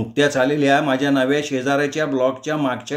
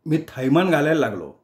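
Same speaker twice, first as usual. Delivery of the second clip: ordinary delivery, nothing marked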